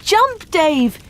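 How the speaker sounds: urgent high shout. A narrator's reading. Villagers to Dave at the upstairs window, shouting. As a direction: call up loudly to someone in danger. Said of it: "they shouted".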